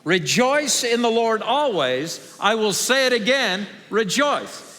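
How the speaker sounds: slow, loud, high group recitation; out loud together. Shown as a demonstration. Pastor leading the church in a verse, out loud together. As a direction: lead the congregation in saying it aloud. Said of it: "let's say it out loud together".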